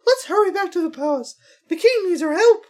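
high, loud regal princess voice; mushroom royalty voice. Acted. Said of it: "best mushroom royalty voice".

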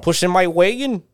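high, loud Upper Midwest lilt; North Dakota accent. Pretend. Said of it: "North Dakota accent".

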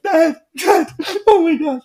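high, loud mock wail; hammed-up tearful kid voice; crying child. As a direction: imitate a boy bawling for his dad. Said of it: "he's crying".

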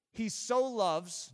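"so" drawn out slow; stress on SO. Shown as a demonstration. Preacher pointing congregation to God's love, stress on SO. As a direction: linger on the word "so". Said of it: "you notice I emphasized so".

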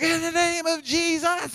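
high, slow mock shout of zealous leaders; imitated shouting. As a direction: holler it out, drawn out and high. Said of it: "like, shouting".

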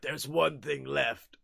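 low, slow menacing villain; mocking Shredder's solemn threat, low and slow; ominous villain voice. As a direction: drop the voice low for revenge. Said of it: "Shredder is just like".

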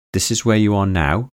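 usual speaking voice

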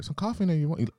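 high mock mom. Mom offering coffee again, in a high insistent voice. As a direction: keep pushing the coffee as mom.

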